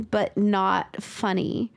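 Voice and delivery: normal talking voice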